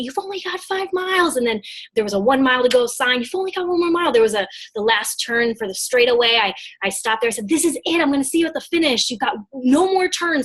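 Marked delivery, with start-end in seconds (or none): 0.0-1.4 encouraging cheer. Retold or imitated. Pointing at sign, cheering him on. 3.2-4.1 encouraging cheer. Retold or imitated. Pointing at sign, cheering him on. 7.5-10.4 excited urging. Retold or imitated. Urging him into the final straight.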